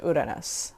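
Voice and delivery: Uranus as "oo-dah-nos", Spanish style; Uranus with a quick tapped r, Spanish-style; Spanish pronunciation. Real, not acted. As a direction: pronounce Uranus the Spanish way, starting "oo". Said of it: "I say it like that".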